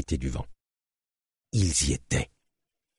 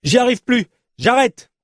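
high-pitched cry at breaking point; desperate outburst; 4 dB louder than usual. Acted. Exhausted cyclist to her companion in the desert, desperate cry. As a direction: cry out that you are giving up.

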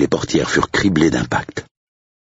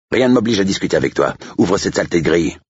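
higher-pitched, irritated demand; impatient estate agent; 7 semitones higher, faster than usual. Acted. Agent orders the gatekeeper to open up, impatient. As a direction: cut the discussion short.

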